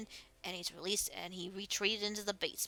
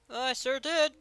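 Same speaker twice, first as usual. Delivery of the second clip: loud, higher made-up officer voice; Dooley; 5 semitones higher, 8 dB louder, slower than usual. Acted. Officer answers the detective's question, made-up loud, high voice. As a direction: answer big and high in character. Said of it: "another guy make up another voice".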